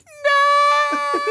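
long, very high mock wail; horrified kid. Older son watching the elf get slapped, mock horrified wail. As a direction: imitate a child's drawn-out shriek of horror. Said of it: "my oldest son is like".

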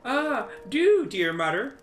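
put-on high, pleading child voice; child begging. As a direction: beg like a hungry little kid. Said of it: "said the second".

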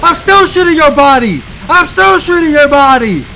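loud, high panicked yelling; frantic yell. Real, not acted. Gamer yelling at the enemy mid-fight, frantic shout. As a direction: scream it while still firing.

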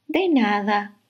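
kindly little bug voice; friendly ladybug. Acted. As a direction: answer like a kind ladybug. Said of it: "le respondió la mariquita simpática".

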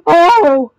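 loud yelp with the voice breaking; high, loud, cracking exclamation. Real, not acted. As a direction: cry out until the voice cracks. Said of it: "my voice really cracked".

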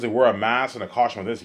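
usual speaking voice

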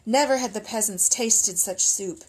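ordinary delivery, nothing marked

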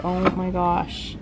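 normal talking voice

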